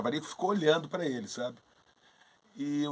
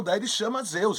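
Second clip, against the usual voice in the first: normal talking voice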